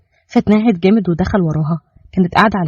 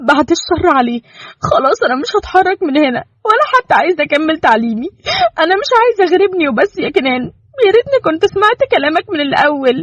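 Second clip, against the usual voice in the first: higher, tearful-edged wife; voiced wife, shaken; 10 semitones higher than usual. Wife telling Kenan she regrets not listening, shaken. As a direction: speak with shaky regret.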